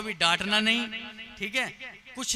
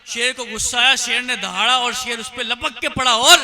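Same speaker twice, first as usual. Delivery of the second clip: loud, high-pitched storytelling surge; dramatic climax; 4 semitones higher, 12 dB louder than usual. A narrator's reading. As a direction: belt out the attack at full force. Story climax, lion roars and pounces.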